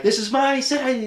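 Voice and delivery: high, loud possessive cry; mock indignant Lex. Lex losing it at Superman, high indignant cry. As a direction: claim the city in a huff. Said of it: "Lex Luthor's like".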